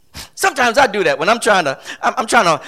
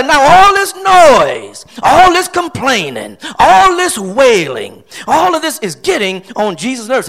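exasperated preaching; 3 semitones higher, 5 dB louder than usual. Real, not acted. Preacher listing what gets on Jesus' nerves, exasperated. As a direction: hammer each phrase in exasperation. Preacher decries the piled-up noise.

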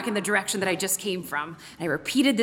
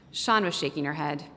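plain reading voice

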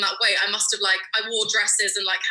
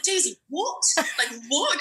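shocked mum; 6 semitones higher than usual. Retold or imitated. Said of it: "She was like".

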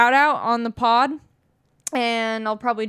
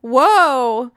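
louder, drawn-out amazement; amazed; 6 dB louder than usual. Real, not acted. Told she predicted her own move, amazed. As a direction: let the realization burst out.

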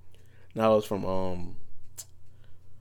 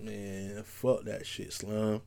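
dismissive; 10 dB quieter, much slower than usual. Retold or imitated. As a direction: drop the voice and shrug it off. Shrugging off life's setbacks.

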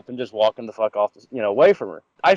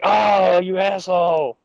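mock dismay; 6 semitones higher, much slower than usual. Friend beaten to the table dance.

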